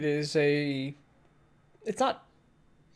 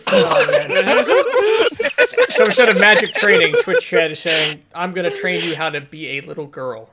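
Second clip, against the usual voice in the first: laughing; 4 semitones higher, 10 dB louder than usual. Real, not acted. GM laughing reading Twitch chat.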